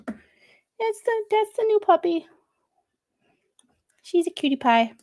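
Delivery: high-pitched cooing over a puppy; cooing puppy talk. Real, not acted. Streamer showing viewers the new puppy, high cooing. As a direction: coo in a high little voice.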